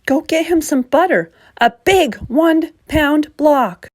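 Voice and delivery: a doctor giving urgent orders; urgent vet. A narrator's reading.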